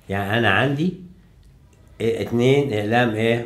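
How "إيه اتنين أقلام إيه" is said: ordinary talk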